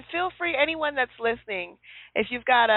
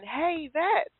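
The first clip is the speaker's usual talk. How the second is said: teasing call; 6 semitones higher, slower than usual. Retold or imitated. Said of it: "hear someone say again".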